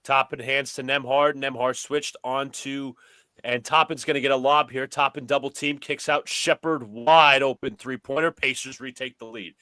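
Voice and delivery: brisk sportscaster calling the action; play-by-play commentary. Pretend. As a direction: call it like a courtside announcer. Plays announcer over the game.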